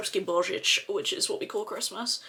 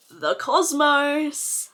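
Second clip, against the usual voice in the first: drawn-out, higher and louder announcement; dramatic reveal; 7 semitones higher, 5 dB louder, much slower than usual. Real, not acted. Revealing the eyeshadow shade to viewers, grandly drawn out. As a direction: stretch the shade name right out.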